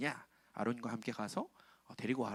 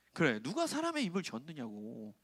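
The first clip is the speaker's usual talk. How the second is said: normal talking voice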